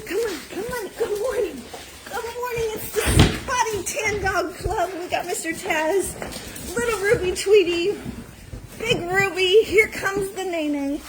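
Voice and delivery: high sing-song coo to the dogs; sweet high pet talk. Real, not acted. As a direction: coo at the pups like babies. Cooing at dogs in barn.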